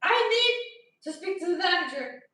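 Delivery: loud, high, firm demand; retold angry demand. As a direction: insist hard on the manager. Demanding the manager.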